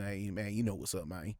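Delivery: soft, low laid-back voice; lightly voiced cool guy, soft and low; hint of a mellow talker; expected smooth voice. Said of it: "talk like this".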